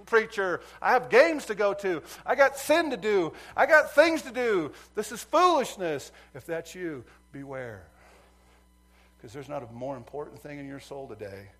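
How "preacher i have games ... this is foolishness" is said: high, shouted mock complaint; hammed-up impatient congregant, yelling; impatient churchgoer. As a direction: hurry the preacher along, loudly fed up. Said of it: "you're sitting right now going".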